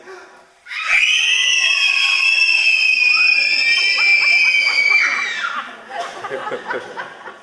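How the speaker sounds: huge high laughing shriek. A narrator's reading. Narrator shrieking as scorpions fall, very high and loud.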